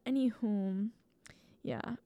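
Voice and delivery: whispered aside, trailing off. Real, not acted. Wrapping up a tangent for listeners, in a whisper. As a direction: drop to a breathy whisper to close the tangent.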